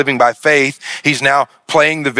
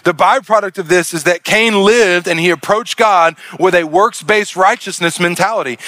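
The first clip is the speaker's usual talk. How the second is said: ordinary delivery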